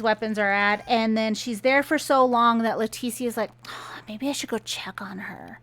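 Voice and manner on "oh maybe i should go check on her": soft, whispery thinking to oneself; hushed acted thought. Leticia wondering about checking on the daughter, hushed. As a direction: imitate a hesitant private thought, under the breath.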